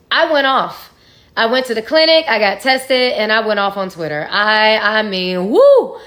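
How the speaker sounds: erupting as she relives the blowup; going off. Real, not acted. Telling viewers how she went off on him, loud and fired up. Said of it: "I was letting his ass have it".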